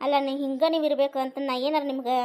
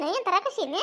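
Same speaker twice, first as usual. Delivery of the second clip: ordinary delivery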